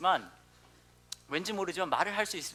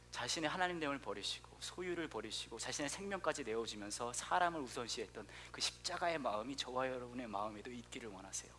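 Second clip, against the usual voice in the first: soft earnest preaching; 5 semitones lower, 7 dB quieter than usual. Acted. Soft closing appeal.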